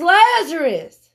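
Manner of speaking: high, loud call to the dead; loud commanding yell. Acted. Preacher as Jesus calling Lazarus from the tomb, loud command. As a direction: cry it out as a ringing command. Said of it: "he yells with a loud voice".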